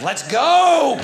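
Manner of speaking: loud high rallying yell; loud Let's go shout; coach shout. Acted. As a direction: yell across the field.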